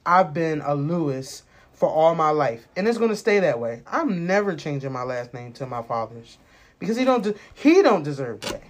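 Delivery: usual speaking voice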